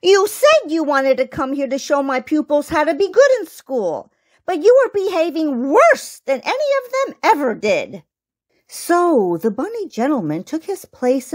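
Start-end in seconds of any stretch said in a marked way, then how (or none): 0.0-8.0 high mouse-teacher reproach; higher, scolding little mouse; sad squeak. A narrator's reading. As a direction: squeak your disappointment at the pupil. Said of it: "squeaked the Lady Mouse teacher sadly".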